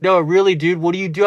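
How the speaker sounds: loud, high-pitched mock pushing question; retold loud demand. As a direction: shout it like a friend who won't accept the answer. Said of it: "They're like".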